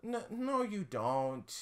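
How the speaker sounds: put-on high, soft nervous child; high, soft child asking him to stop; uneasy kid voice. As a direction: squeak it like a weirded-out kid.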